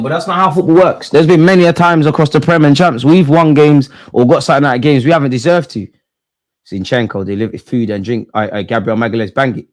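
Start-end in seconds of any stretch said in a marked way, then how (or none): 0.0-5.9 loud rant. Real, not acted. Fan ranting over a lucky result.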